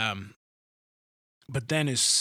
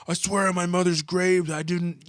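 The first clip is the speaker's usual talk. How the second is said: a higher, overdone liar's vow; mock oath; 7 semitones higher, 3 dB louder than usual. A liar overselling his denial, in mock earnest. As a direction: lay the oath on thick, lightly. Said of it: "They have to over".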